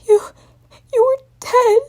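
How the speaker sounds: high, broken sobbing voice. Acted. Spike sobbing into Twilight's chest. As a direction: choke it out through sobs. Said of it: "Spike began to sob louder".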